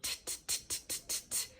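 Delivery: quick unvoiced t t t; repeated T sounds. Shown as a demonstration. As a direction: tap out T repeatedly. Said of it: "let's try it again".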